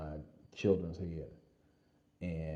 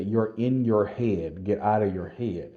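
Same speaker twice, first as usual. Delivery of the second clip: slow, loud, firm call from a running partner; urgent coaching call; 2 semitones higher, 10 dB louder, slower than usual. Retold or imitated. Running partner urging a sprinting soldier, slow and loud. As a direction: drag out every word and press them.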